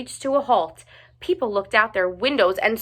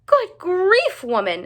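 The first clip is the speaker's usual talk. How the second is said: much higher, louder outburst; exclaiming; 10 semitones higher, 4 dB louder than usual. A narrator's reading. Principal shocked at the giant teacher. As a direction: blurt it out in shock. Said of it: "exclaimed Principal Renfield".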